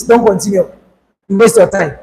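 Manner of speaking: ordinary talk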